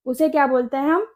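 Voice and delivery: ordinary talk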